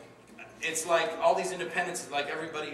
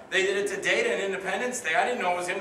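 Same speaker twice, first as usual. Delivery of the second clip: louder, higher retort; arguing back; 5 semitones higher, 7 dB louder than usual. Retold or imitated. Actor argues back for third role.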